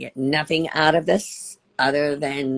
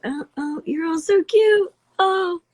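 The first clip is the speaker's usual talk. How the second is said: much higher, louder cooing; gushing coo; 4 dB louder than usual. Real, not acted. To fans on the stream, a high gushing coo. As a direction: coo at them like they're adorable.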